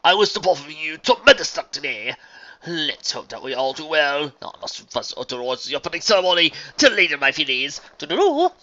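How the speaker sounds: theatrical good wishes, rising to a high sign-off; grandiose stallion bidding farewell. Acted. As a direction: send them off grandly.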